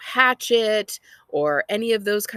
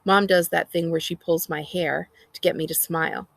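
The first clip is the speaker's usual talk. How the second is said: plain reading voice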